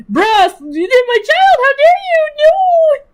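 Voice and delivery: high, hammed-up outrage building to a shriek; over-the-top squeal of mock betrayal. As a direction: scold the storm like a wronged parent. Scolding the wind dramatically.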